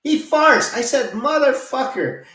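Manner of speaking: high, loud indignant cry; venting disgust at the guy; loud disbelieving curse; outraged. Real, not acted. Outraged at fart in elevator.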